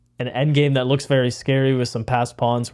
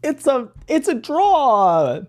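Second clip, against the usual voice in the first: dramatic reveal; 11 semitones higher, slower than usual. Acted.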